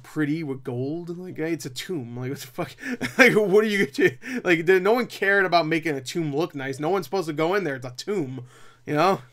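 usual speaking voice

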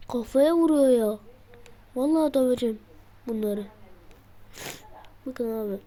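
normal talking voice

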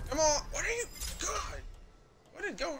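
high-pitched incredulous protest at the game; your voice jumps up in disbelief; exasperated disbelief. Real, not acted. Gamer baffled by the game, high incredulous protest. Game does something unfair.

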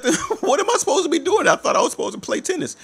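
high, quick, laughing protest; giggling mock innocence; laughing innocent. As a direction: play dumb through a laugh. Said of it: "I said".

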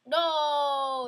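loud, high no; real dismayed shout; frustrated shout. Real, not acted. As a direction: yell it as you fall. Falls through the platform.

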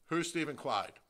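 ordinary talk, nothing marked

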